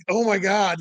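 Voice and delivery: ordinary delivery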